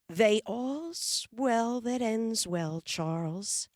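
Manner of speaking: slow, high soothing voice; gentle interruption. A narrator's reading. Ma cutting in to end Pa's fight story, slow and soothing. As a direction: settle it calmly and slowly. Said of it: "Ma interrupted".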